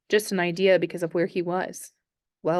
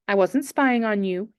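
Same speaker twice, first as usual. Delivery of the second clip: plain reading voice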